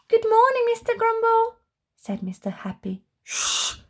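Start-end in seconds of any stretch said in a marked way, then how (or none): 0.1-1.6 cheery greeting. Acted. Happy greets Grumble cheerfully. 3.2-3.8 sharp unvoiced shush; quick hushing shh. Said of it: "shushed Mister Grumble".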